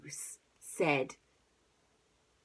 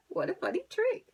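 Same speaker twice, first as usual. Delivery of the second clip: ordinary delivery, nothing marked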